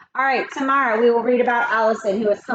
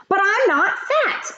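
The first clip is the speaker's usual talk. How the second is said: put-on higher, slow, insistent child voice; insisting kid; 9 semitones higher, slower than usual. Eric Bacon protesting to classmates, higher insistent kid voice. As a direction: plead your case like a skinny kid. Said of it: "Eric Bacon insisted".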